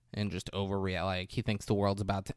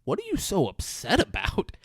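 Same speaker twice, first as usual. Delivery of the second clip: baffled husband; 7 semitones higher than usual. Retold or imitated. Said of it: "she's really upset and he's like".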